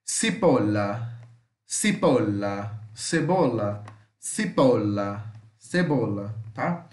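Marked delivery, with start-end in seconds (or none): 0.1-2.8 Cipolla said slowly and lower, double L as a single L sound, stress on po; Italian pronunciation demo. Shown as a demonstration. Teacher models Cipolla slowly for his students. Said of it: "mas nós vamos falar com som de L". 4.2-5.3 Cipolla said slowly and lower, double L as a single L sound, stress on po; Italian pronunciation demo. Shown as a demonstration. Teacher models Cipolla slowly for his students. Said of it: "mas nós vamos falar com som de L".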